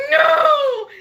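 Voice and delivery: a hammed-up despairing shout; mock wail. At the fake baby needing burping again, a hammed-up despairing shout.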